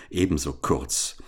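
usual speaking voice

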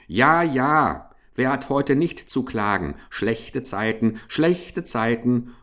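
plain reading voice

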